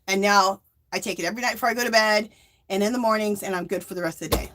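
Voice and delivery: ordinary talk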